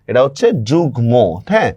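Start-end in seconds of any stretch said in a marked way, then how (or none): 0.7-1.1 যুগ্ম as "jug-mo", m fully sounded. Shown as a demonstration. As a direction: say both g and m clearly in যুগ্ম. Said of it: "মফলার উচ্চারণই কিন্তু হচ্ছে".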